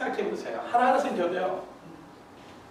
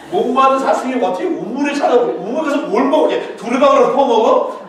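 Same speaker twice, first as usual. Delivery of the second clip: mocking disbelief; 8 semitones higher, 12 dB louder than usual. Real, not acted. Mocking the wrong song lyrics.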